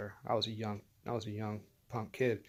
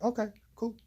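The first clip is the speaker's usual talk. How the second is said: higher-pitched, compliant retold reply; mock-obliging, pitched up; meek agreement; 9 semitones higher than usual. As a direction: go along with it in a high little voice. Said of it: "So I was like".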